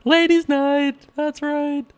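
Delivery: squeaky high falsetto; silly high-pitched voice. Pretend. Poker streamer joking to viewers about ladies' night, high-pitched voice. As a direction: put on a daft voice, way up high. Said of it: "the only high-pitched voice".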